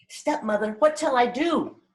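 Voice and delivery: put-on high-pitched childlike question, rising sharply before it falls; pleading child's question. Child asking stepmother what to do when a stranger speaks, high-pitched and questioning.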